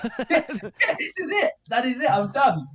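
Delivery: high, laughing giving up. Real, not acted. Musician laughs at the thought of dying on tour. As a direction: laugh it out, resigned.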